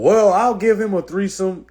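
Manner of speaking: high, loud mocking woman; sing-song mock girlfriend. Mocking women's offer, high girly voice. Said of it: "that whole".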